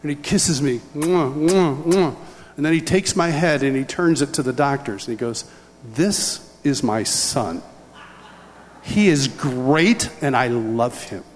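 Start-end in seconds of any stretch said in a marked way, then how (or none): 0.9-2.2 exaggerated "mwah" smooches, one after another. Acting out father's kisses. 5.9-11.2 slow, weighty, heartfelt announcement; proud father's declaration. Retold or imitated. Father proudly presents son to doctors.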